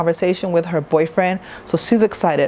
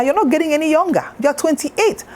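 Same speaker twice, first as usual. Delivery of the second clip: lightly voiced scolding auntie, high; a hint of an unimpressed auntie; 9 semitones higher than usual. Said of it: "Telling her".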